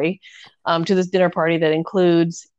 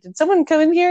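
much higher, light mock stewardess asking; 9 semitones higher, 3 dB louder, faster than usual. Night stewardess asks Anne about a man, high mock voice. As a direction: pitch it up like a prim stewardess.

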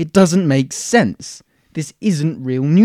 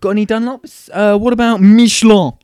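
mock posh customer; 7 semitones higher, 6 dB louder than usual. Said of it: "You ask for".

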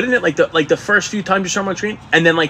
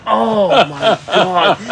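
a stretched-out, higher, laughing "oh my God"; shocked audience gasp; 5 semitones higher, 3 dB louder, much slower than usual. Retold or imitated. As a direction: play a stunned crowd, slow and laughing. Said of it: "the whole fucking audience is like".

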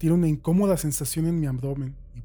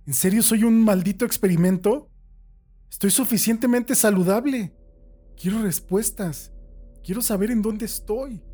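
indignant outburst; 7 semitones higher, 4 dB louder than usual. Acted. Prisoner arguing with captor.

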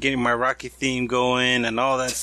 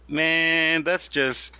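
ordinary talk

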